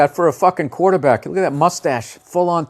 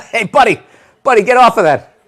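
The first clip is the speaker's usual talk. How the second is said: higher, louder stern parent; scolding parent; 7 semitones higher, 7 dB louder than usual. Pretend. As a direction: scold the kid off the furniture. Parent scolding a kid named Buddy.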